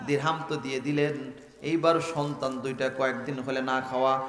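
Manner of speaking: ordinary talk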